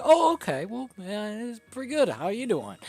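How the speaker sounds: high, chirpy clueless voice; mock cheerful bystander; clueless friendly reply. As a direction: act like you have no idea. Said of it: "They're like".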